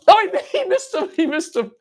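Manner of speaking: very high squealed dismay, easing down; playful dismay. Pretend. Toy player watching fish man miss, squealing in mock dismay. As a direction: squeal as your fighter misses. Toy fighter misses its target.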